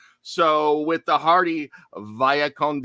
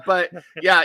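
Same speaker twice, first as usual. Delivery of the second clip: usual speaking voice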